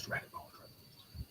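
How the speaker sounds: very soft, voiceless whisper; hushed, jokey narrator aside. Pretend. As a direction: whisper it like a stage direction. Whispered aside inside the acted quote.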